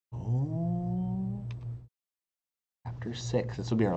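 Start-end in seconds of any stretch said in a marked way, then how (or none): none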